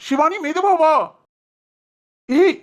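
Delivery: high, loud alarmed yelps; startled, telling her to slow down; man startled as his girlfriend rushes at him; alarmed shout. Acted.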